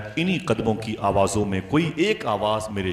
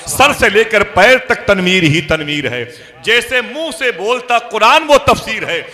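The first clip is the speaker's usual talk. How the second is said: loud, high declamation of praise verse; rousing poetic sermon delivery; impassioned preaching; 8 semitones higher, 10 dB louder than usual. Real, not acted. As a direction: build to a ringing, full-force climax.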